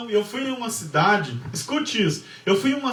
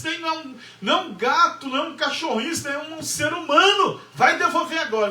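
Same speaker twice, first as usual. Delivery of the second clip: husband's outburst voiced higher, pitch way up; angry husband; 6 semitones higher than usual. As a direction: demand it back, indignant and raised. Husband furious about the baby. (marido bravo, voz bem mais aguda exigindo que devolva o bebê)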